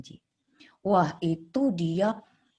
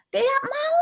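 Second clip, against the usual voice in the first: put-on child's angry refusal; slower than usual. Angry child refuses, parents give in.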